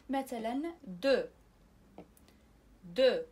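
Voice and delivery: دو as "du", with no x sounded; French دو, final x not sounded; silent x. Shown as a demonstration. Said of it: "كنشوفوا إكس لكن لا تنطق".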